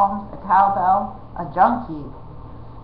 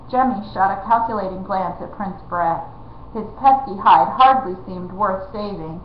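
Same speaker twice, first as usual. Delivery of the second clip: ordinary delivery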